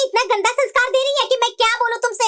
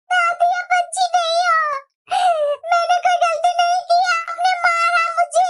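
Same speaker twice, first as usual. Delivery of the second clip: put-on higher, slow child voice talking back to grandma; granddaughter talking back; 4 semitones higher than usual. Little girl answering back to her grandmother, slow child voice. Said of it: "दादी को गलत ठहराते हुए".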